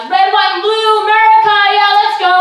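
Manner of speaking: high, loud patriotic whooping; rowdy patriot. Acted. Comic telling of an American on Fourth of July, rowdy cheering. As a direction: holler it like a mock superfan.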